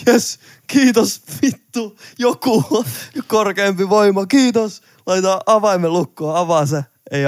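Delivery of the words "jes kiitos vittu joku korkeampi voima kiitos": high, slow, hammed-up relief at the door; mock praise to the heavens, stretched out; exaggerated grateful cry, much higher; relieved thanks. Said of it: "Mä oon".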